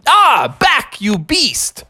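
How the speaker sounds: high, drawn-out storybook alarm; alarmed cry. Acted. Bear fending off a biting cobra, alarmed. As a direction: ward off the snake in high alarm.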